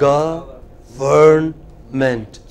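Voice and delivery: "Government" drawn out very slowly; slow teacher model of "Government"; slow syllable drill. Shown as a demonstration. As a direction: stretch out each syllable of "Government". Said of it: "break it into syllables".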